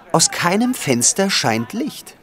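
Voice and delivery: usual speaking voice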